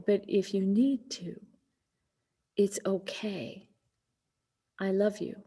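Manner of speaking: hushed, slowing, gently giving permission; tender reassurance. Retold or imitated. Daughter comforts her dying mother.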